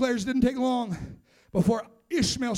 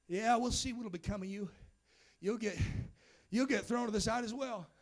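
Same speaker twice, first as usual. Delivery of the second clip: scoffing; 2 semitones lower, 11 dB quieter than usual. Acted. The past taunting the promised son, quiet scoffing. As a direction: scoff under your voice, much softer.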